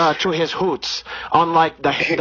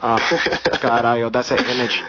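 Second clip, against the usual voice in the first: put-on Brazilian accent; 2 semitones higher than usual. Comedian holding a put-on Brazilian accent.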